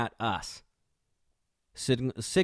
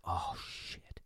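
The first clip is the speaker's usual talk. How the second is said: a soft, breathless whisper of dread; 4 semitones lower, 12 dB quieter than usual. Retold or imitated. Imagined driver getting pulled over, whispered dread. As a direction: mutter it as your heart sinks. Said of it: "you're like".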